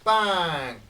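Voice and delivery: loud spoken bang on the shot; playful gunshot bang; mouth gunshot. Pretend. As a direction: fire it off with your mouth. Gunshot sound effect while sniping.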